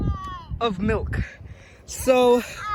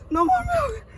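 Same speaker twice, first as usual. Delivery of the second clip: crying; 13 semitones higher than usual. Real, not acted. Fighting over the last milk.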